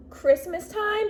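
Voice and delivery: normal talking voice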